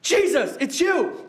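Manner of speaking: thrilled outburst of recognition; excited. Acted. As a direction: exclaim it like you just recognized him. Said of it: "was so excited, he was like".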